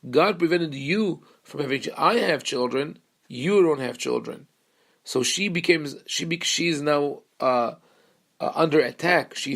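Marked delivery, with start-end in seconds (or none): 0.1-4.5 contrastive punch on YOU and I; accusing weight on the pronouns you and I; stress on YOU. Retold or imitated. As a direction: pitch jumps on you and I, setting them against each other. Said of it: "she hears the emphasis on the you".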